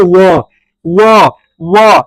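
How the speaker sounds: व repeated long and loud, each one separate; teeth-lip sound demo. Shown as a demonstration. Teacher demonstrating teeth-and-lip sound, loud drawn-out व. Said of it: "दंत और दांत और ओष्ठ से".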